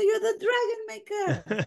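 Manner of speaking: high, emphatic encouragement; reassuring. Retold or imitated. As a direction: lift him up with conviction. She reassures the father.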